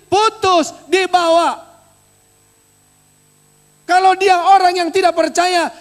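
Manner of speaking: loud, high pulpit proclamation; preacher booming the promise at the church; fiery preaching. Acted. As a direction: thunder the promise to the congregation. Preaching at full force.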